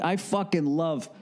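ordinary talk, nothing marked

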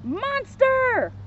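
high, drawn-out alarmed call; storybook shout, pitched high without full volume; mock shout. A narrator's reading. Shaggy takes Scooby for a monster, high mock shout. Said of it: "Shaggy shouted".

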